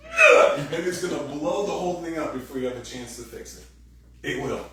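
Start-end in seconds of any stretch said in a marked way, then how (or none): 0.1-0.6 loud, high exasperated ugh; exasperated groan. Retold or imitated. Cornered spouse blowing up, loud high exasperated groan. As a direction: explode in frustration. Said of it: "you're just gonna be going".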